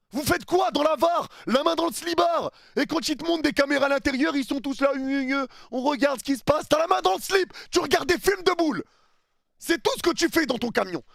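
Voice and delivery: high, loud scornful jeering; mocking taunt. Fan taunts the VAR referees in their truck, loud high scorn. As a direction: sneer at the referees, voice pushed up high.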